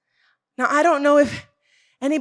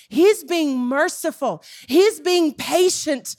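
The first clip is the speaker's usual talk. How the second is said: slow emphatic preaching; 3 semitones higher, slower than usual. Shown as a demonstration. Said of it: "slow emphatic preaching".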